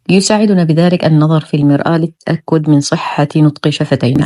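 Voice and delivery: usual speaking voice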